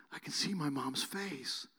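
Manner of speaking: slow, soft, awed near-whisper; a boy seeing his mother's face for the first time; awed boy's hush. Retold or imitated. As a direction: let the wonder hush the words.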